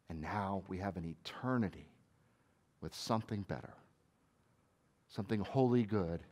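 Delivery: soft, intimate reassurance; gentle comfort. Pretend. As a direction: speak softly as if wiping away tears. Comforting someone, wiping tears.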